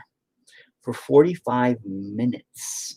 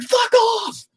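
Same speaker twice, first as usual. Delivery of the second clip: very high screamed dismissal; re-enacted yell. Father refusing to give staff his name, high scream. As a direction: scream it at them, drawn out and furious. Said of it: "I was yelling at 'em".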